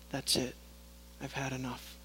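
low, hushed, fed-up fury; a dark whisper of divine anger; hushed furious God. Acted. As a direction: speak God's anger barely above a whisper. God declares he's done.

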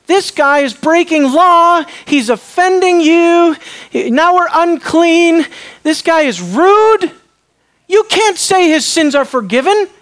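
loud, high-pitched mock outrage; oburzeni uczeni w Piśmie. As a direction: protest indignantly, drawing out the accusations. Acting out the offended scribes.

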